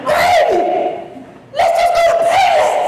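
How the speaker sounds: loud, high-pitched mock wife squeal; wife's voice. Wife calling to husband in the store, high mock squeal. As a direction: shriek it as an exaggerated impression of a wife. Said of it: "That's how she talked".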